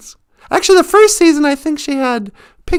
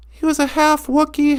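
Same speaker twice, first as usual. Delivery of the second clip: ordinary talk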